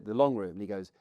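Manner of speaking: ordinary talk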